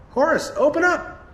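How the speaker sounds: high, loud call through the door; urgent call to a friend inside. Acted. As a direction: shout for him to open up. Knocking on locked ambulance, calling.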